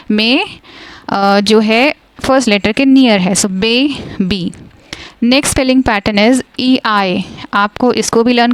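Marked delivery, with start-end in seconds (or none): 3.5-4.5 "बे, बी" broken as "bay... bee"; split into syllables. Shown as a demonstration. Teacher repeats the two syllable example, "बे, बी" split apart. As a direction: say "बे" and "बी" as separate beats. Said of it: "यहाँ पे भी दो syllables हैं".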